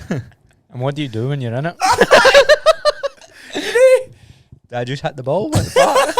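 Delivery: laughing. Real, not acted. Laughing at his old self.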